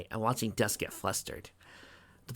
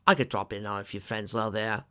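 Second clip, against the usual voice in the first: ordinary delivery, nothing marked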